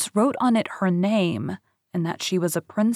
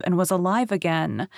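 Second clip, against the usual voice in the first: ordinary delivery, nothing marked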